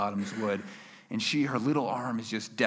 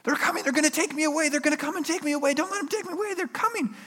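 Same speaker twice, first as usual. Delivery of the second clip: very high, fast frantic rush; high-pitched, racing hysterical plea; hysterical child; faster than usual. Retold or imitated. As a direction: imitate a panicked kid babbling without a breath. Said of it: "she is hysterical. She's going crazy, yelling".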